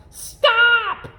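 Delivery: high, loud shrieking stop; high shrill crowd scream. A narrator's reading. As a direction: shriek it like a crowd of angry guests. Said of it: "screamed his guests".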